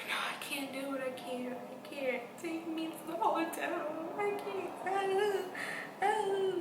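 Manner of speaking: high, tearful pleading; crying. Retold or imitated. Patient begging the driver, high and tearful. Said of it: "I was like".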